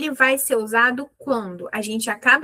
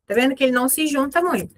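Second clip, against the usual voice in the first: ordinary talk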